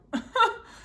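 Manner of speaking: a short, high, embarrassed laugh; high burst of laughter. Real, not acted. Laughs at her own answer.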